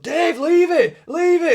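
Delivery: high-pitched, loud mock plea; squealed-up "leave it" impression; imitated pleading shout. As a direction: act out a mate calling him off. Retold companions holding older man back.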